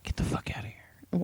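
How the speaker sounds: whispered incredulity; hushed disbelief. Real, not acted. Hearing about competitions, whispered disbelief.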